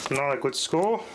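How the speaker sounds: ordinary talk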